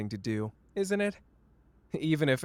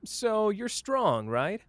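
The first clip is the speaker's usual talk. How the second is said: teasing; 9 semitones higher, much slower than usual. Acted. Teasing partner's grade.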